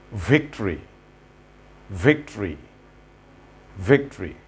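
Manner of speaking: victory said as VIC-tri, three syllables squeezed; victory with no o sound, vic-tri; model pronunciation. Shown as a demonstration. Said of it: "The right pronunciation is".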